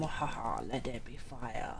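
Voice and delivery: low, slow evil cackle; evil laugh. Acted. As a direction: gloat low and slow like a villain. Quoting the game villain line.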